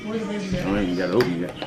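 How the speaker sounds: normal talking voice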